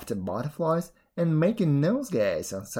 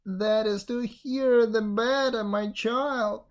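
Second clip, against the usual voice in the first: raised voice of a wolf playing granny; disguised wolf; 6 semitones higher, slower than usual. A narrator's reading. Wolf as grandmother reassuring girl, raised false voice. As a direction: answer high and evenly, posing as grandmother.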